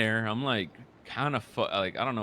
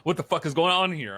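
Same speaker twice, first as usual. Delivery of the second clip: retold outburst, higher and louder; indignant, raised-voice bewilderment; exasperated disbelief; 5 semitones higher, 5 dB louder than usual. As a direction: act out baffled protest, voice jumping up. Acted baffled protest in retold story.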